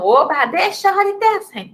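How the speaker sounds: high-pitched put-on glee; mock cheering. Acting a pupil hearing accents are gone, high mock glee. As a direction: cheer like a pupil let off the rules.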